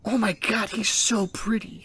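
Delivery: high gush sinking into a slow whisper; hammed-up awed admiration; mock swooning. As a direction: gush breathlessly over a crush. Heroine swooning over the demon lord.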